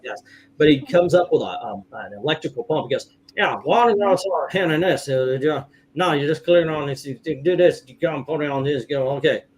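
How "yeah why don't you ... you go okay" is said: imitated garbled mumbler, words run together; unintelligible mumble. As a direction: mumble it so barely a word comes through. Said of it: "Didn't understand a word".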